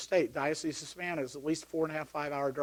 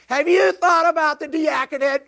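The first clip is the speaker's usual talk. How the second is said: imitating a full stadium yell, much higher and louder; shouted question; 12 semitones higher, 13 dB louder than usual. Priest yelling in Doug's ear at the game, shouting. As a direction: bellow it right into his ear. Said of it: "At the same voice".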